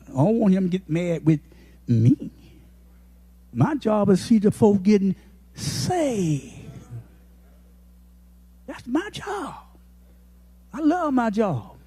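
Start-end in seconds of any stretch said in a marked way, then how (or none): none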